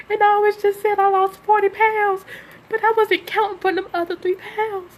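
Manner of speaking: real ugly cry, voice breaking; snotty crying. Real, not acted. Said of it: "I got an ugly cry".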